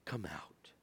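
soft whispered summons from far away; whispered call. Acted. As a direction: breathe it out as a whisper. Jesus's voice calling the dead.

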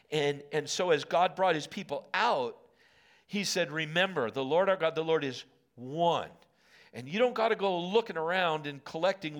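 preaching at full force. Acted. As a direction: preach it hard to the congregation.

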